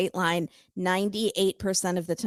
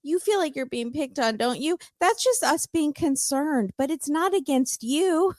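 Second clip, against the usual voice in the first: higher-pitched mock soothing; a hint of patronizing baby talk; babying grandma; 6 semitones higher than usual. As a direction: lightly mimic someone comforting a baby. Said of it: "talks to Mina a little bit like she's a baby".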